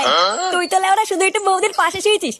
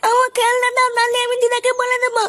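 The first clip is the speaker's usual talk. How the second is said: put-on higher, childish sulky voice; a stubborn little boy demanding his toy back; pouting child threatening to tell grandma; whiny kid; 5 semitones higher than usual. Son whining to his father.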